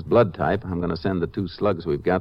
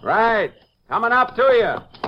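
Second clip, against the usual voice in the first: calling out; 13 semitones higher, slower than usual. Acted. Calling back up the slope.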